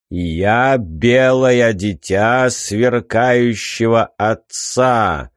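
slow, grand riddling sphinx voice; solemn proclamation. Acted. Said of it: "торжественно провозгласил сфинкс".

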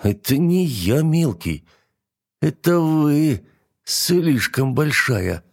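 lightly voiced sheepish young knight; timid knight. A narrator's reading.

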